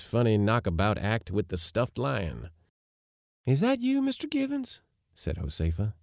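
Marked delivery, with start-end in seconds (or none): 3.5-4.8 high, sugary woman's voice; saccharine. A narrator's reading. Josefa to fallen Givens, saccharine. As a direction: lift it high and sweet. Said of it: "in her deliberate saccharine contralto".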